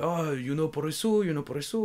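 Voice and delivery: high-pitched mock Japanese English; strong Japanese accent. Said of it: "avec un fort accent japonais".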